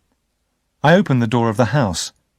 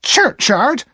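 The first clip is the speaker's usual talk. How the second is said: snapped back, high and louder; sharp repeat; 14 semitones higher, 4 dB louder than usual. Acted. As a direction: fling the word back at him. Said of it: "Missus Joe repeated sharply".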